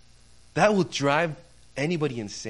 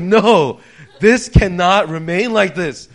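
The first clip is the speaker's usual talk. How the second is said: loud, emphatic, slowing declaration; indignant outburst; 3 semitones higher, 12 dB louder, slower than usual. Retold or imitated. Customer denied a refund refuses to accept it, loudly indignant. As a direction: take a stand, spacing out the words. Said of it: "I was about to explode".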